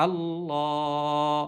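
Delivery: Allah with the লা held long and slow; slowly drawn Allah, long vowel held. Shown as a demonstration. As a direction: stretch the long a in Allah by one alif. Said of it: "একালি পরিমাণ টেনে পড়ব".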